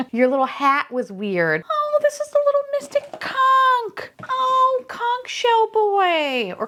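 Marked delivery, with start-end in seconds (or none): 1.7-6.5 very high, gushing coo; cute-overload squeal. Real, not acted. Collector greeting the little conch toy, high gushing squeal. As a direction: squeal and stretch the last words.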